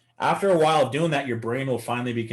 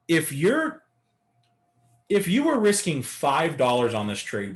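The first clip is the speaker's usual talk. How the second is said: ordinary delivery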